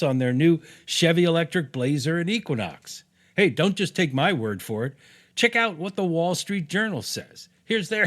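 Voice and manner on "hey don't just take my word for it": ordinary talk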